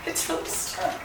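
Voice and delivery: whispered strain while tugging; breathy effort. Real, not acted. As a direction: mutter through the effort. Struggling with a product.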